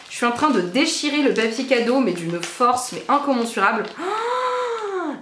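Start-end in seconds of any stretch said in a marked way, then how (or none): 4.0-5.1 much higher, long gliding oh of delight. Real, not acted. Spotting the heart blush from her friend, delighted oh. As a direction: melt at the sight of it.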